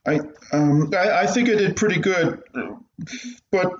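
put-on a scared kid hiding something from his mother; nervous young boy. Boy stammering to his strict mother.

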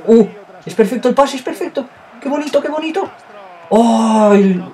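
high, loud cries of delight at the pass; thrilled cries. Real, not acted. Gamer admiring a perfect pass, high delighted cries. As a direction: gush over it, then wail at the miss.

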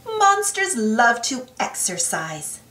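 ordinary delivery

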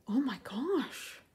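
slow, breathless whispered gasp; awestruck, almost no voice. Acted. Ariel finding shiny treasure, gasping in a whisper. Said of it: "Ariel gasped".

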